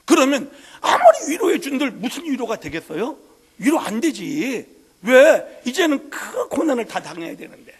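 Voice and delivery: full-voiced Korean sermon cadence; preaching. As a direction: preach it with rising force. Preaching with rising intensity.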